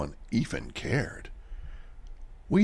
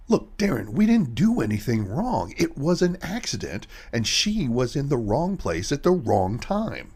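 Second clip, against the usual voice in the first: pitched up and pushed harder, pressing the point; a louder, higher, insistent plea to drop it; heated insistence; 7 semitones higher, 4 dB louder than usual. Acted. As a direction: talk him out of it with urgency. Heated argument about the accident.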